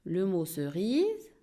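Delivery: ordinary talk, nothing marked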